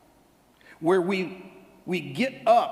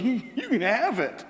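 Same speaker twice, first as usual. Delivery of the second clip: higher voice with a laugh in it; nervous excited boy; 5 semitones higher than usual. Acted. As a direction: stammer it out, overawed and delighted. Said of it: "That shaky hand goes".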